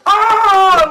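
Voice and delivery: high loud shout; a crowd erupting; crowd yell. Acted. GM voicing the crowd as the champion is slammed.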